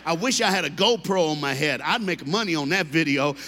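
ordinary talk

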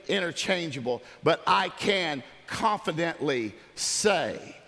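ordinary talk

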